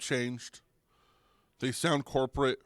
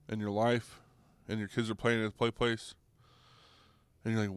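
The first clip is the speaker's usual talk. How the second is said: usual speaking voice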